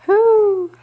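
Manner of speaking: high, loud, sustained owl hoot. Pretend. Parent answers what the owl says, owl hoot. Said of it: "what does the owl say".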